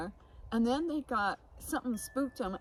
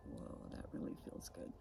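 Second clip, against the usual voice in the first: breathy whispered relief, much softer; relieved whisper; 13 dB quieter than usual. Retold or imitated. Describing the ritual to the host, a soft whispered whoa. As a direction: whisper it like a weight just lifted. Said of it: "I'm like".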